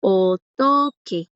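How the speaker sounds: slow, careful "Otoque", each syllable drawn out; teacher models "Otoque" slowly for students; slow Korean word demo. Shown as a demonstration. Teacher drilling students, slow syllable-by-syllable model. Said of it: "Este de acá".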